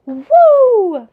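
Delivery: high, loud hammed-up whooping; mock rowdy cheering. Mocking a loud foreigner who gestures too much, hammed-up whoops. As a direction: play the loud foreigner taking up space. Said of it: "taking up so much space".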